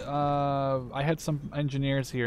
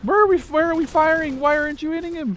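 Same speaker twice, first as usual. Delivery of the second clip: exasperated yelling; 13 semitones higher than usual. Real, not acted. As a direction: yell at the useless soldiers. Yelling at useless units.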